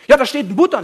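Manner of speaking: loud, high mock outcry; acted agitated complaint. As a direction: play the worked-up believer. Acting out a worried neighbour's complaint.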